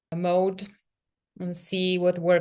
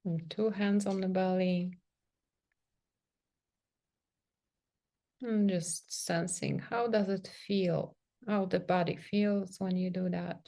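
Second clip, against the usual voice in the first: soft, unhurried meditation voice with long pauses; guided meditation; 4 dB quieter than usual. Real, not acted. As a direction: gently guide them into sensing the body, leaving space between phrases. Leads a guided self-touch meditation.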